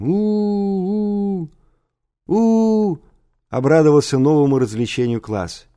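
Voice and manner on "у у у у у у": long high-pitched wolf-like howl; drawn-out primal howling; shaggy howl. A narrator's reading. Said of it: "вознесём мохнатый вой".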